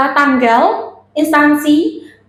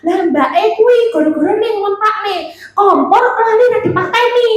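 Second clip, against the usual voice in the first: high, rapid-fire gossip chatter; terlalu cepat; 7 semitones higher than usual. Shown as a demonstration. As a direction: rattle it off excitedly without a breath, pitched high. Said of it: "ojo cepet nemen". (bicara nyerocos cepat dengan nada tinggi)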